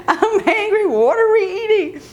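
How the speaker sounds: high, hammed-up hungry complaint; hangry teen, imitated. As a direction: demand food in an exasperated high voice. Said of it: "combination of hungry and angry".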